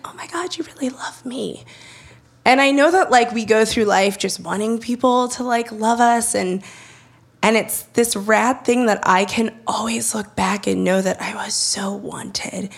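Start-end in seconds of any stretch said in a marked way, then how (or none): 0.0-1.6 soft, higher whisper of wonder; awed whisper. Retold or imitated. Realizing her mom's love. 11.0-12.7 slow, soft whisper; hushed. Real, not acted. Reflecting on being adopted.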